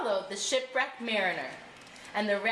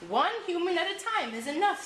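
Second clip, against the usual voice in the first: small fish voice; 8 semitones higher than usual. A narrator's reading. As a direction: pipe up as the tiny fish. Small fish cautions the whale.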